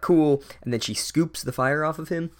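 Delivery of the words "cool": higher, louder offhand "Cool."; mock casual. Bossy Girl setting Snape on fire, mock-casual. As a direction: toss it off unbothered. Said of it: "Bossy Girl is like".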